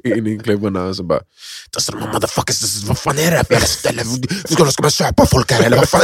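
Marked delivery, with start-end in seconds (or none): 1.8-6.0 mock rant. Retold angry rant at club.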